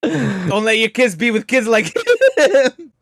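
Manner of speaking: laughing mock advice; mock warning. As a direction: warn the parents, grinning. Teasing warning to audience.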